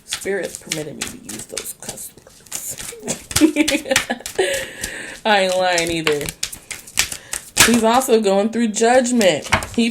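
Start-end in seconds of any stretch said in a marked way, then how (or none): 0.0-3.0 soft, half-breathed aside; hushed aside. Real, not acted. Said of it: "I'm not really talking for myself". 3.1-5.2 airy unvoiced laugh; a soft breathy giggle. Real, not acted. Laughs after cussing.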